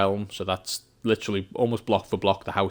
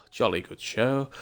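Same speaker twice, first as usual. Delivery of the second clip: mock English gentleman, higher pitch; Englishman; 4 semitones higher, slower than usual. Shown as a demonstration. English streamer proving he is not Australian, hammed-up English accent. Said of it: "as an Englishman".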